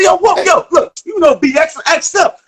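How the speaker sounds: high, hyped-up trash talk; hyped shout. Retold or imitated. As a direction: call out to your crew, pumped. Hyped trash talk mid-game.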